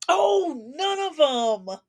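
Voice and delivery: high, drawn-out sarcastic answer; mock realization. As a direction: draw it out high in mock surprise. Mockingly answers own rhetorical question.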